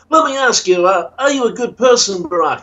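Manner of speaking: high-pitched interviewer impression; high, put-on questioning voice; Comfort. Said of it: "Hey, Comfort," going".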